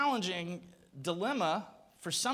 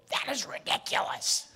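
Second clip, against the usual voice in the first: indignant voiceless whisper; strained whisper; 5 semitones higher than usual. Retold or imitated. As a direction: push an outraged whisper with no voice. Angry whisper, no voice.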